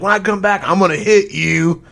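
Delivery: high, loud imitation of a screaming son; screamed threat. Son threatening his mom with a punch, screaming. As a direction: yell the threat, stretching out the end. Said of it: "screaming at his mom".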